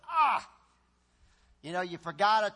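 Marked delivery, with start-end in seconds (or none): 0.1-0.4 high, loud "ah"; loud high groan of regret. Shown as a demonstration. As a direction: groan like you blew it. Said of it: "where we went".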